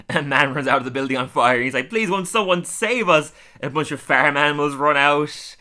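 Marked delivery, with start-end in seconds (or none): 1.9-3.3 high, loud retold cry for help; pitched-up mock panic; mock desperate plea. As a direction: plead for rescue in a hammed-up way. Man fleeing burning building pleads.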